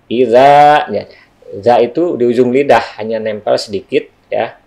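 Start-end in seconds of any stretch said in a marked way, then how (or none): 0.1-0.9 "iza" louder and higher, drawn out; pronunciation demo. Shown as a demonstration. Teacher showing cara bacanya, "iza" held out loud. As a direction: show "iza" with the za at the tongue tip. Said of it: "Cara bacanya".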